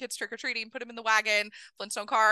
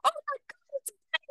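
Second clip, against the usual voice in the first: squealing much higher "it's Betty!"; excited crowd screaming; faster than usual. Retold or imitated. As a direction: fans spotting a star. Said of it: "I hear everybody screaming".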